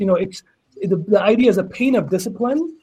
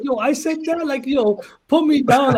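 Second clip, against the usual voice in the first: imitating high-pitched eager excitement; excited brother; 7 semitones higher than usual. Brother asking to be credited for the quote, excited. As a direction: act out a thrilled brother, pitch raised. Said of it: "He got so excited".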